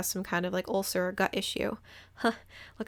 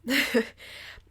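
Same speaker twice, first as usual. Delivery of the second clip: breathy laugh; 5 semitones higher than usual. Real, not acted. Laugh after story beat.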